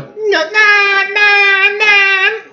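high stabbing film-score squeals; repeated falsetto screech; Psycho screeches. Retold or imitated. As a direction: imitate the shower-scene strings. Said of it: "Like that movie Psycho".

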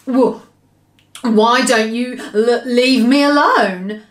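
slow, loud, stumbling protest; nervous storybook giant tripping on a word; nervous stammer. A narrator's reading. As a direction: stammer as you plead to be left alone. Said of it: "he stammered".

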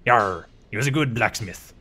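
hammed-up pirate Cornwall accent with a yarr. Gamer voicing Andre about the giant, in a pirate accent. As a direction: growl it like a stage pirate. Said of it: "it's just talking like a pirate".

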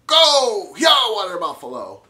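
high, loud cheering yell; excited shout. Acted. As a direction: cheer it wildly.

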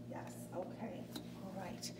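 breathy whispered okay while serving. Pretend. Flight attendant serving meals, whispered aside. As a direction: murmur it under your breath.

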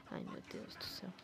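breathless hushed whisper; whispering while hiding. Real, not acted. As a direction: whisper it so nobody hears. Recovering from scare while hiding.

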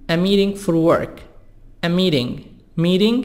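"meeting" said miring, T as a soft D; flap T. Shown as a demonstration. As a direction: tap the T in "meeting". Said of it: "T soft D".

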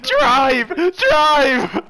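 imitating own frantic shouting, high and loud; retold panic. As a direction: re-enact the panicked plea. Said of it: "I was like".

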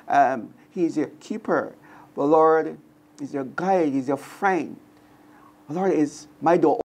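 ordinary talk, nothing marked

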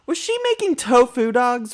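high, loud indignant question; outraged surprise. Real, not acted. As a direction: fling it at her in disbelief. Outraged discovery of tofu dogs.

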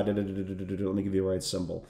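quiet, low filler hum while searching; muttering to himself. Real, not acted. Trader muttering to himself while hunting for the right stock symbol, quiet and low. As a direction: mumble under your breath while looking something up.

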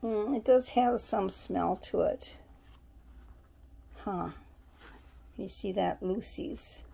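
usual speaking voice

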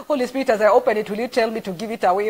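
fast, eager plea to the Spirit; excited prayer. Retold or imitated. Retelling her car prayer, quick and eager.

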